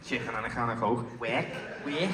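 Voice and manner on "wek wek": Scouse accent. Shown as a demonstration. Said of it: "teach Elijah to do a Scouse accent".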